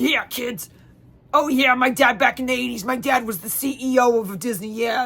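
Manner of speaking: imitating the rambling Uber driver; odd Uber driver voice. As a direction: do the driver as she bragged. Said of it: "she talked like this".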